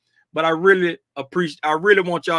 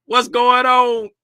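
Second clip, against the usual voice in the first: ordinary talk, nothing marked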